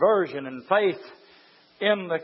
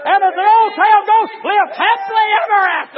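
high, loud shouted preaching; full-force preaching; 14 semitones higher, 13 dB louder than usual. Acted. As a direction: preach it hard, raising the roof.

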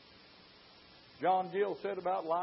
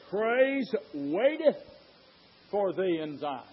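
"Praise waiteth" drawn out very slowly, word by word; slow scripture reading; slower than usual. Shown as a demonstration. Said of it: "And notice what it says".

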